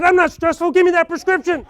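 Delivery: angry denial. Retold or imitated. Sam snaps at the dentist that he is not stressed, loud and indignant. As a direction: demand it, outraged and laughing at yourself.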